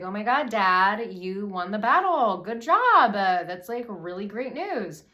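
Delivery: loud, high, gushing congratulations; excited daughter. Acted. Daughter congratulating Dad on winning the battle, excited. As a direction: cheer loudly, pitch leaping up.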